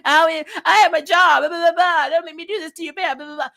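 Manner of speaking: sarcastic high whine of a pleading officer; mocking whiny Renee. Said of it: "All we've seen is Renee saying".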